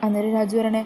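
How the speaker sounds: plain reading voice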